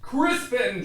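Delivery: loud angry call of his name; angry shout. Acted. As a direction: bellow his son's name. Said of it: "shouted his father".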